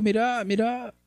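high soft "mira, mira", coaxing; gentle Jesus. Pretend. Jesus to the widow, high soft coaxing. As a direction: lean in and coax her softly. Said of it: "Le dijo".

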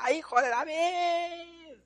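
high, slow wailing plea; pleading blind beggar. Retold or imitated. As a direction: stretch it out, begging for mercy. Blind man begging Jesus for mercy.

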